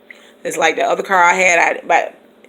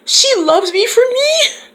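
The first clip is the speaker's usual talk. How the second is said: much higher, slow, touched squeal; excited squeal; 14 semitones higher, 4 dB louder, slower than usual. Real, not acted. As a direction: squeal it out, overcome with delight. Gasps, touched by a subscriber's love.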